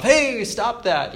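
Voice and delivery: a hammed-up road-rage shout; mock yell. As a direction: play-act yelling at someone who cut you off. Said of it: "you know, yell at them".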